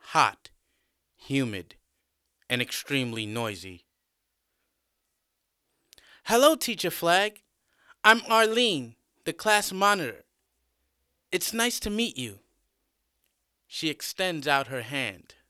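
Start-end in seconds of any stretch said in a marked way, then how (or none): 6.3-12.4 high-pitched, eager class monitor; young female student. A narrator's reading. Arlene, the class monitor, introduces herself to her new teacher, high-pitched. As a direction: greet teacher brightly in a high girlish voice.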